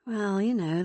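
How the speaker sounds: plain reading voice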